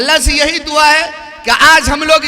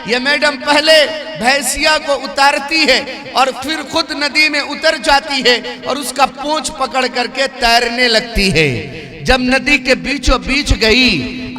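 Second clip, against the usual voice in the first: ordinary talk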